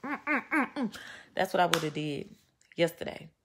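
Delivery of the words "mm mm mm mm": higher, louder, laughing menace; quoting Cisco. Retold or imitated. Quoting Cisco's threat about grabbing a neck, laughing. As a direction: laugh through the threat, hammed up. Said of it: "like Cisco said".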